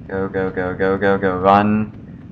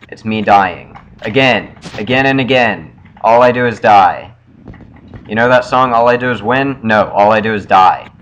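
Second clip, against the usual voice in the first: frustrated; 8 dB louder than usual. Real, not acted. Loud frustrated rant.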